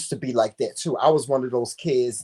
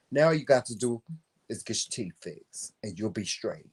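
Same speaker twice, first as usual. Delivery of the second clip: low, quiet, matter-of-fact imitation of a child talking like an adult; grown-up little boy; 6 semitones lower, 7 dB quieter than usual. Little boy advises an adult in conversation, talking like he's grown. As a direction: advise softly, as if man to man. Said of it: "He said it like he was grown".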